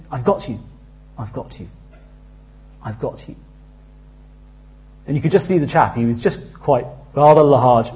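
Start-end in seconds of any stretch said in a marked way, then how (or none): 0.1-3.4 soft, quiet calm reassurance; lifeguard-like stranger calming him, soft and steady; reassuring rescuer. Retold or imitated. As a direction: settle a panicking swimmer gently. Rescuer reassuring drowning man.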